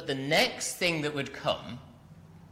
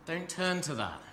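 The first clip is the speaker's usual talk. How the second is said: higher, soft, concerned plea; pleading parent; 5 semitones higher, 8 dB quieter than usual. Acted. Parent warning a child, softly pleading. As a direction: gently urge a child away from harm. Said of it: "more like a parent to a child saying".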